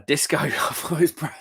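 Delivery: laughing through a mix-up of names. Real, not acted. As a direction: giggle out the correction. Mixes up two chat names.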